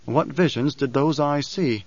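usual speaking voice

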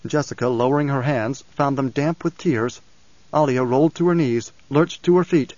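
ordinary delivery

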